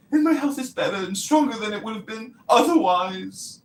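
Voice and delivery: hammed-up English accent, mocking a vaccine believer. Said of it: "the mockery".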